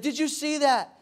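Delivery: high-pitched little boy imitation; proud child. Small son asking dad after his jump, imitated proud child. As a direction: pitch it up like a kid after a feat. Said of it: "He's like".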